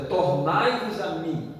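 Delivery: drawn-out, solemn plea; slow, solemn preacher's call. Acted. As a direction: stretch out each word of the divine invitation. Preacher quoting God's call.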